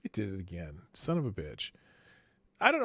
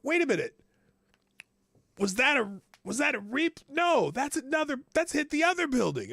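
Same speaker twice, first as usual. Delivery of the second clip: much higher, urgent retold alarm; alarmed disbelief; 12 semitones higher, 3 dB louder than usual. Coworkers watching the second plane hit, alarmed disbelief. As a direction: relive spotting the second plane.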